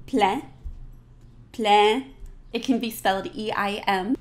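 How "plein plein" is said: Plein with nasal E, no n; French plein. Shown as a demonstration. Teacher modeling plein, nasal E. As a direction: say Plein as plan. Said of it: "Say it with me".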